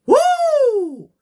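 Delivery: very high, loud sustained whoop; whoo. Acted. Host hyping a wrestler's match, high whoo. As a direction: let out a piercing, drawn-out whoo. Catchphrase whoop between lines.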